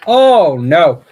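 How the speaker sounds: loud panicked oh no; alarmed cry. Real, not acted. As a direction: cry out as it goes wrong. Something breaks at full power.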